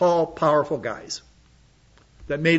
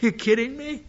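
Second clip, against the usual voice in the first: incredulous; 7 semitones higher than usual. Real, not acted. Incredulous at wanting Egypt.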